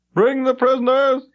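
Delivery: high, loud official summons; commanding call. Acted. High Froman calling out to the coppers, loud and commanding. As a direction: call the prisoners forward like a ruler.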